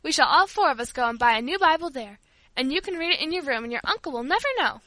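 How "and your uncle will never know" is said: ordinary delivery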